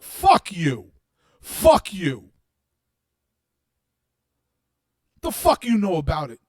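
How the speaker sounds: furious shout. Real, not acted. Cursing at critics who mock peace, shouting. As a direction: spit it out in fury. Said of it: "I'm angry right now".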